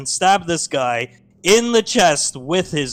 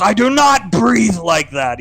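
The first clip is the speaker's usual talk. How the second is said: louder, higher offended denial; insist, affronted; indignant denial; 6 semitones higher, 5 dB louder than usual. Acted. Hunk denying he breathes like Darth Vader, offended. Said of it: "And he goes".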